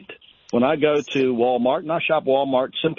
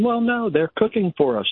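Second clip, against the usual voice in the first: mocking quote; 8 semitones higher than usual. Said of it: "someone in your house is saying".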